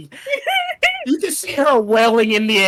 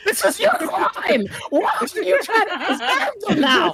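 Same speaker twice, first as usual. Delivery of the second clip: high, laughing shouted scolding; exasperated yell; 7 semitones higher than usual. Acted. Game master yelling at chicken about her eggs. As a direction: berate them, half laughing.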